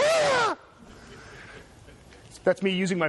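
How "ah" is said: sudden high shriek of fright; imitating a terrified yelp; startled scream. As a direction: scream as if a shark appeared. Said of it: "She'd turn around and go".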